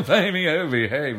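put-on high, loud, laughing "Baby, oh, behave"; hammed-up groovy spy with a laugh; Austin Powers impression. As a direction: Austin Powers catchphrase belted out at a corporate gig. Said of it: "I was doing Austin Powers".

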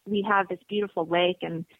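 normal talking voice

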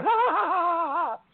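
high-pitched maniacal mock laugh; put-on villain's laughter; Fiend. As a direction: cackle back in a long high run. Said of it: "the Fiend starts laughing back at him".

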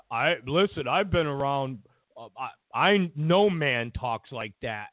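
low-pitched imitation of a solemn denial; imitating self-important testimony, low; mock earnest man. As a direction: drop the voice low to mock his seriousness. Imitating Jake Tapper and others denying.

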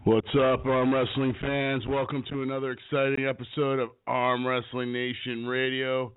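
hoarse from strep throat; a sore, roughened throat voice; rough voice. Real, not acted. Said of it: "sorry for my rough voice today".

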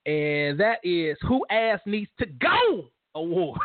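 slow, drawn-out announcer voice; award announcement. Acted. Host to fans, unveiling his new award in slow announcer style. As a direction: stretch every word of the award title.

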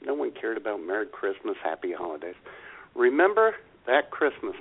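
ordinary talk, nothing marked

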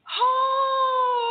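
high, loud echo of the call. Retold or imitated. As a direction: shout it back high and long. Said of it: "And this boy said".

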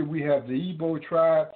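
normal talking voice

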